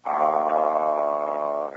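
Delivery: a long, open "Ah" with the jaw, lips and tongue left still; open ah sound. Shown as a demonstration. Demonstrating the japa sound "Ah" to listeners, long and relaxed. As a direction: let "Ah" fall out with no effort at all. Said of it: "The only sound you can make is".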